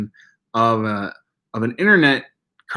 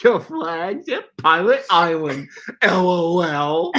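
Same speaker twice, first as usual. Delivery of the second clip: slow, drawn-out cartoon voice with a laugh; silly cartoon character, dragging each word; slow goofy cartoon reading, laughing; Homestar Runner voice; 4 semitones higher, slower than usual. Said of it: "I'll do it with the comments right now".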